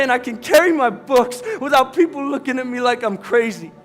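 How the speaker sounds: loud, high, breaking with tears; tearful shouting. Acted. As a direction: cry out every dream at her. Dennis shouting his dreams, crying.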